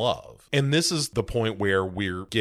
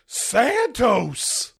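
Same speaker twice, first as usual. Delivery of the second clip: Marietta cries out; 11 semitones higher than usual. Retold or imitated. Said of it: "Marietta goes".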